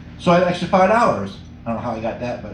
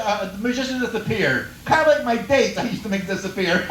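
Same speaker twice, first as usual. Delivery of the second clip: ordinary talk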